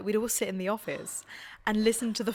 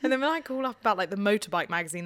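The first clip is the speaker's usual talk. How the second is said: ordinary talk, nothing marked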